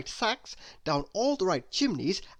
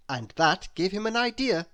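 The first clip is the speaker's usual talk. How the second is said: plain reading voice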